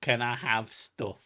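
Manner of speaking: loud, wheedling parody of a partner; mock partner impression. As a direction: imitate a partner sweet-talking for favours. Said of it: "Is that what I sound like?".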